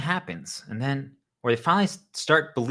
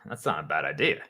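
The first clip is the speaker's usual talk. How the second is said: normal talking voice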